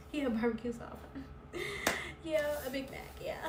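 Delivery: mock giggling, soft and breathy through the words; giggly laughing. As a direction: imitate her giggling along. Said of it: "she's just laughing like".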